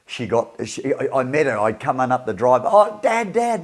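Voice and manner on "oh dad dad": put-on higher, excited teen calling Dad; excited daughter. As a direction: voice the daughter bursting with news. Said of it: "and all excited".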